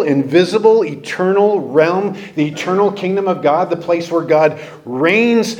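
ordinary talk

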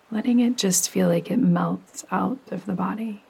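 calm, unhurried meditation voice with long pauses; soothing yoga nidra narration, evenly paced; guided meditation. Acted. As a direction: guide the listener gently down into rest.

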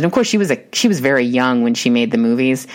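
usual speaking voice

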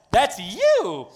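loud, high pointed jab; mock accusing husbands. Husbands to wives in church, loud mock accusation. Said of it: "husbands looked at their wives and went".

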